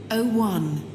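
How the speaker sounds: ordinary delivery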